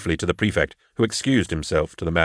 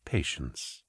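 very soft, low murmur; soft, intimate near-whisper; 5 semitones lower, 15 dB quieter than usual. A narrator's reading. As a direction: say it hushed, letting it fall away. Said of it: "he whispered".

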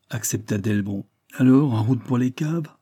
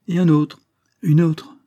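tense; 7 semitones higher, 6 dB louder than usual. A narrator's reading. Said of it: "Delbon se crispa".

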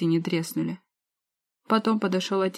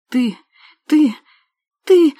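sputtering rage; 8 semitones higher, 12 dB louder, slower than usual. Acted. Sister choking with rage.

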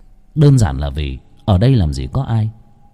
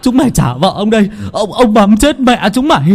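loud, high raging shout; furious cursing; 8 semitones higher, 4 dB louder than usual. A narrator's reading. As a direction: curse the ghosts in fury. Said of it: "không ngừng quát lên chửi rủa". (gào chửi giận dữ)

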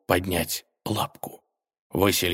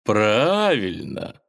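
slow drawn-out villain agreement; sly villain; 2 semitones higher, 4 dB louder, much slower than usual. Acted. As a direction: agree slowly, savouring it. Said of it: "согласился злодей". (злодейски протяжно)

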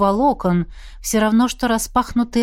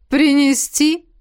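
higher, jeering question; mocking offer; 7 semitones higher, 4 dB louder, slower than usual. A narrator's reading. Izergast taunts with an offer to fetch it, mockingly. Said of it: "глумливо предложил Изергаст".

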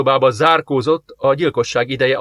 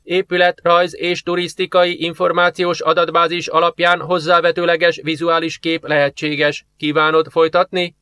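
emotionless computer; 5 semitones higher than usual. Acted. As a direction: read it like a cold computer. Computer voice continues. (érzelemmentes géphang)

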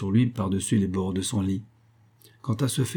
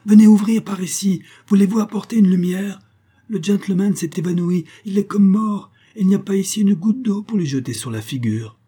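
high, loud urgent cry for help; piercing call; 10 semitones higher, 7 dB louder than usual. A narrator's reading. Boy calling the guards as Richard faints, piercing shout. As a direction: yell down the corridor for water and light. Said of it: "appelant d'une voix perçante".

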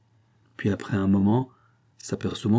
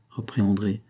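ordinary delivery, nothing marked

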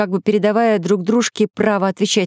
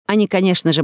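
plain reading voice

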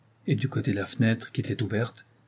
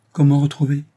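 louder, higher cry of surprise; startled exclamation; 4 semitones higher, 8 dB louder than usual. A narrator's reading. Wife startled by her husband's news, exclaiming. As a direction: exclaim it, taken aback. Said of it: "s'écria Herminie".

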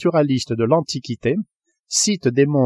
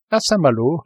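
ordinary delivery, nothing marked

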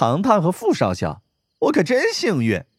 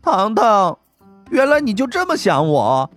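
higher, hurt and sulky; aggrieved; 8 semitones higher than usual. A narrator's reading. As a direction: whine it like you're wounded. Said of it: "她委屈地说".

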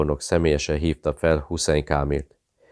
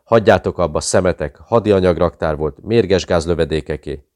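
desperate shout; 2 semitones higher, 6 dB louder than usual. Acted. Beaten pilot confessing to his torturers, desperate shout. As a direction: yell it out to stop the beating. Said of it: "kiáltotta Nicky Tyne a csukjája alól".

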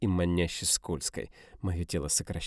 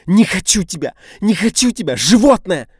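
much higher, louder shrieks; hysterical screaming; 13 semitones higher, 16 dB louder than usual. A narrator's reading. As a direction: scream it at him. Dina fighting him.

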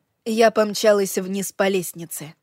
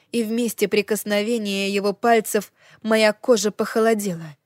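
ordinary delivery

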